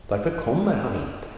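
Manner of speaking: kommer stressed, varför, han and inte short; Varför kommer han inte with weight only on kommer; model stress. Shown as a demonstration. Teacher summing up the sentence stress for learners. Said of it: "Short pronunciation or no emphasis".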